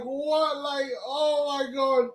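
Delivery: high, clipped shocked "What?"; surprised what. Retold or imitated. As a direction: mimic the teammate's disbelief. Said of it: "he's just right there like".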